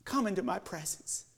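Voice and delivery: soft, tender welcome; gentle invitation. Acted. The Father welcoming the believer, soft tender. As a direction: invite them in quietly. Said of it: "the Father smiles and says".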